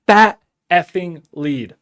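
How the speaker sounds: drawn out, every word leaned on; slow emphatic gloat. Real, not acted. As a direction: savor each word slowly. Gloating over a hot sales lead.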